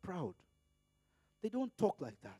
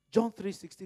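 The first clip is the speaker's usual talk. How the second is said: ordinary talk, nothing marked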